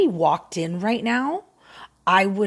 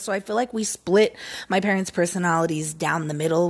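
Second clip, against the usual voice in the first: ordinary delivery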